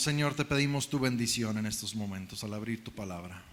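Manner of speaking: soft, reverent opening prayer; hushed congregational prayer. Real, not acted. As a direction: pray quietly before the reading. Opens prayer after sermon.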